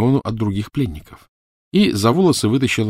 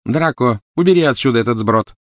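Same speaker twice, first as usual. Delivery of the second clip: higher and louder, curt order; commanding; 5 semitones higher, 4 dB louder than usual. A narrator's reading. Bellatrix ordering Draco to clear the prisoners away. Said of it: "приказала Белатриса".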